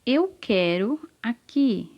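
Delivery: slow, word-by-word reading of the sentence; careful reading aloud. Shown as a demonstration. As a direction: read slowly for beginning readers, pausing between words. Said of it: "aqui tá escrito". (leitura pausada, palavra por palavra)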